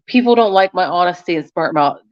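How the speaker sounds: ordinary delivery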